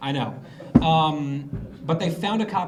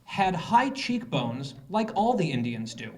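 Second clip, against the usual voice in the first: slow, louder deadpan quote; quoting her; 3 semitones higher, 3 dB louder, slower than usual. Retold or imitated. Comedian reading Warren's quote slowly, louder and deadpan. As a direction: read her words slowly and pointedly. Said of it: "The following words are her words".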